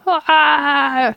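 high, drawn-out "aah"; alarmed cry. A narrator's reading. Man feeling his body distort, alarmed cry.

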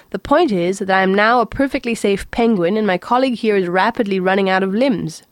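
ordinary delivery

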